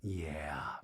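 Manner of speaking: low, soft fading to a whisper; mock Schwartz dropping to a whisper; imitating Schwartz. Recapping the show, mimicking Schwartz with a low, whispery yeah. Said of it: "And Schwartz is like".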